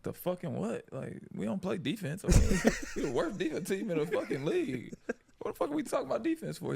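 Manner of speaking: high-pitched comic protest with laughs; indignant disbelief. Retold or imitated. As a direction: argue back high and baffled, half laughing. Retold protest to coach, amid laughs.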